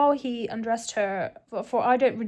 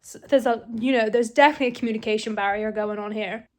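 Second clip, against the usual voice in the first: ordinary talk, nothing marked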